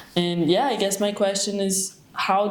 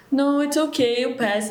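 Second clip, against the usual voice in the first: higher, gentle comforting voice; soothing a kid; 7 semitones higher than usual. Retold or imitated. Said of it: "you try to be like".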